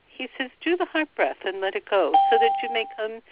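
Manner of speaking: ordinary talk, nothing marked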